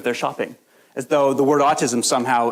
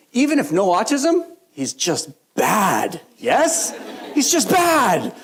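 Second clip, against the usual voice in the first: high, emphatic imitation of grandma's broken English; broken English, drawing out "bad" in exasperation; 7 semitones higher, 3 dB louder, much slower than usual. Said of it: "She had very broken English".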